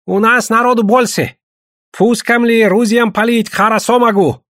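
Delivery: high-pitched voice in broken Russian; voiced character talking big in broken Russian; boasting native hunter. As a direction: brag eagerly, pitched well above your own voice.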